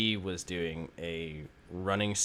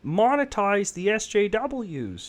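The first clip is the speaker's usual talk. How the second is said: much higher, louder mocking pundit voice; pitched-up parody of a self-satisfied lecturer; Jordan Peterson impression; 13 semitones higher, 10 dB louder than usual. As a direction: lampoon a smug commentator explaining his money scheme. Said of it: "That's a good impression".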